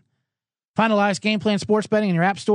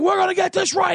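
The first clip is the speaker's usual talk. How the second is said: mock coach; 12 semitones higher than usual.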